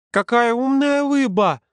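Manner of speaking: put-on high, slow little-boy voice with childish r's; Олежка. A narrator's reading. Little boy whose fish got away, undaunted. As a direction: exclaim like an undaunted small child. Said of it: "Олежка, тем не менее, не унывал".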